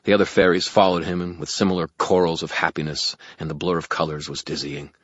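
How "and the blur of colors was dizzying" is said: ordinary delivery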